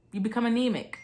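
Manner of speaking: normal talking voice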